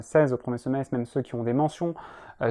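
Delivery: ordinary talk, nothing marked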